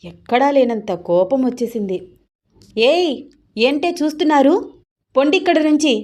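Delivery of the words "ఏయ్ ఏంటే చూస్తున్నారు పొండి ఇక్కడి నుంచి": put-on higher and louder, chasing the girls off; a wife's jealous yell at the college girls; loud angry yell. A narrator's reading. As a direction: shout them away from the husband. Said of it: "చాలా గట్టిగా అరిచింది సీత".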